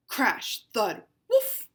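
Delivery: bang out the noises; sound effects. Acted.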